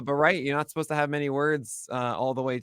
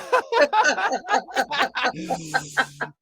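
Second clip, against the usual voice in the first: laughing fit; 13 semitones higher than usual. Real, not acted. Breaks up mid-reading.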